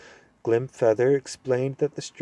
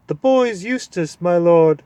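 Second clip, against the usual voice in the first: high, loud hooting owl; 7 semitones higher, 8 dB louder than usual. Acted. Owl hooting loudly to the deaf dwarf. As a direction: hoot it as loud as he can. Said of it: "hooted the owl ... as loud as he could".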